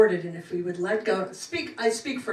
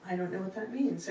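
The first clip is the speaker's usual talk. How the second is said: ordinary delivery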